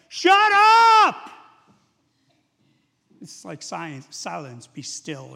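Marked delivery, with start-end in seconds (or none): 0.1-1.3 huge, high yell over a storm; commanding shout. Acted. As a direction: bellow the order at the wind. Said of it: "he says to the wind and the waves".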